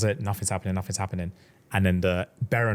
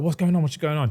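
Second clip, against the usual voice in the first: alarmed question; 7 semitones higher than usual. Retold or imitated. Heroine confused by her friends' silence, high and alarmed. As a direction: ask in rising panic. Heroine notices everyone's faces.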